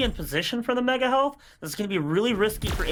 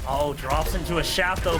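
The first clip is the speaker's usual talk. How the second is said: lively live match call; esports caster. Real, not acted. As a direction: narrate the action live. Live play-by-play commentary.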